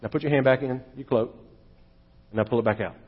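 a hushed low divine command; quiet God voice. Retold or imitated. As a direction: murmur the instruction calmly, low and soft. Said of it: "And God says".

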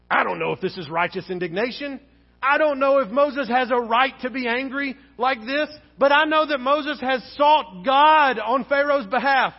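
usual speaking voice

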